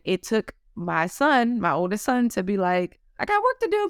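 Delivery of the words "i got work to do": much higher mocking little-boy voice; son's high womanish voice. Oldest son parroting his working mom, high womanish voice. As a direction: pitch it way up like a kid copying mom. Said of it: "he would say in a high voice, like a woman".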